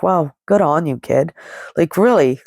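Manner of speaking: ordinary talk, nothing marked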